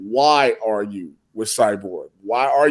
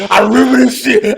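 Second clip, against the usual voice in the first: mock outburst; 7 semitones higher, 6 dB louder than usual. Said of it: "You was like".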